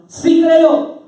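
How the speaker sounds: high, loud cry of belief; impassioned exclamation; exclaiming father. Acted. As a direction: shout it out with conviction. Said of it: "el padre exclama".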